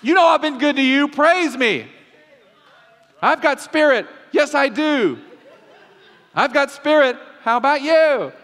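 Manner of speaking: high, loud cheerleader chant; cheerleader God. Pretend. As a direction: pump up the crowd like a cheerleader. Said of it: "almost like a cheerleader".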